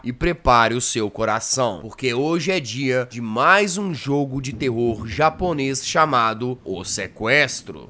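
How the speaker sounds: low, slow announcer voice; announcer intro. Acted. Host opening a horror game episode, low slow announcer voice.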